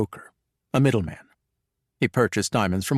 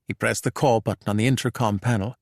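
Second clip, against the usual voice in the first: ordinary delivery, nothing marked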